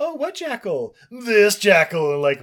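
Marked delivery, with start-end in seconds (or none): 0.0-0.9 put-on very high boy voice; high-pitched naive query; questioning Mowgli. 1.1-2.1 loud, slow, drawn-out reveal; big, leisurely creature voice; Kaa. Acted. Kaa shows off his bulge.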